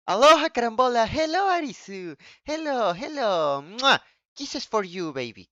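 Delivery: bright singsong welcome with a blown kiss; cheerful greeting. Real, not acted. Streamer greeting arriving chatters.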